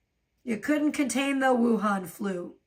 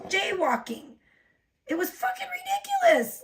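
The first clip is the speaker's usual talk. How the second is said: outraged. Real, not acted. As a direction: pitch jumps way up in disbelief. Outraged at jaywalking ticket.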